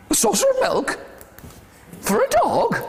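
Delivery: high-pitched, incredulous squeal; squeaky astonishment; astonished. Acted. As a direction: question it in high disbelief. Astonished reaction to dog's request.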